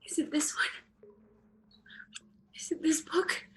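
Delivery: soft anxious whisper; hushed frantic searching. Acted. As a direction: whisper as if hunting for something. Ghost girl searching the books.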